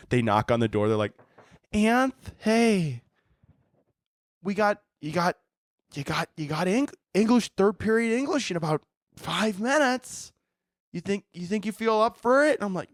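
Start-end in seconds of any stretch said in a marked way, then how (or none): 1.7-12.5 high, halting, careful coaxing; gingerly coaxing colleague. Acted. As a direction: tiptoe round someone having a breakdown. Staff coaxing him out of closet.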